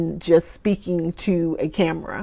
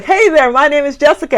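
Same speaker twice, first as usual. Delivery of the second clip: higher, louder TV anchor intro; 7 semitones higher, 6 dB louder than usual. Retold or imitated. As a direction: open the show like a news anchor. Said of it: "I did the whole anchor voice".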